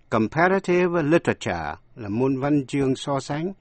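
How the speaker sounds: ordinary talk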